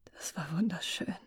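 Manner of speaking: breathless hushed whisper; barely voiced, just air. Muriel whispering to Glenna after their kiss.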